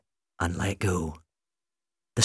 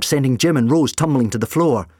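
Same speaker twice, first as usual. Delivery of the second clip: plain reading voice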